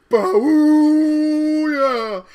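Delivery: very loud high blast; hammed-up horn boom; loud horn imitation. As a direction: blare it like a horn. Quoting horn blast in movie.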